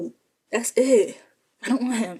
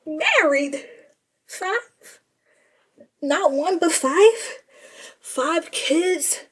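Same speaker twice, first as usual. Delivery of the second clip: high stunned repeats; shocked disbelief; 6 semitones higher, much slower than usual. Retold or imitated. Teller reacting to the married news, high and stunned. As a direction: echo each word in disbelief.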